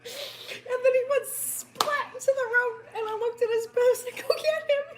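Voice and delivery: high, giggling retelling; helpless laughing. Real, not acted. Telling the brother to fetch him, retold laughing. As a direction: crack up while reporting the splat. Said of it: "I can't tell the story without laughing".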